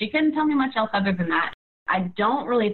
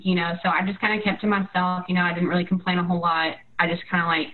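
ordinary delivery

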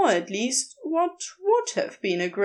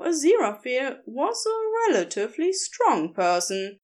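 usual speaking voice

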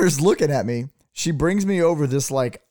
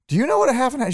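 imitated exasperation, pitched way up; mock incredulous; 11 semitones higher, 4 dB louder, faster than usual. Imitating bar owner challenging waitress on the order, high-pitched incredulity. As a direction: question them in high, mock disbelief.

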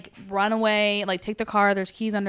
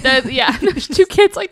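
laughing; 4 semitones higher, 9 dB louder, slower than usual. Real, not acted. Laughing recalling final scene.

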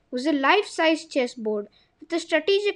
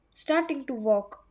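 ordinary delivery

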